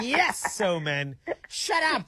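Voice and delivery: exasperated, high-pitched outburst, retold; mock frustration. Home taper to a radio DJ talking over the intro, mock frustration. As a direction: act out fed-up yelling at the radio. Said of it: "the frustration when the radio D J talks over".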